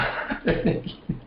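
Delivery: light breathy chuckle; real amused chuckle; amused laugh. Real, not acted. Amused laugh after his punchline.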